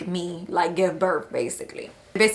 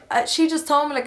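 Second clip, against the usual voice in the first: ordinary talk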